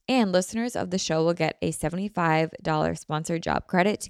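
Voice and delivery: podcast sponsor read, smooth and steady. Acted. Host pitching the sponsor to podcast listeners, ad read. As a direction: sell the job site like a host-read ad.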